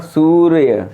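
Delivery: सूर्य stretched out to show र ahead of य; र before य. Shown as a demonstration. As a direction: sound out सूर्य with a distinct र, then य. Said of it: "या से पहले रा का उच्चारण हो रहा है".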